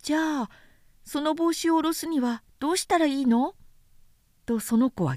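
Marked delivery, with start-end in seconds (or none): 0.0-3.5 put-on light, high boyish voice, curious; a small boy asking innocently; little prince voice. A narrator's reading. Little prince asking the vain man, high childlike voice.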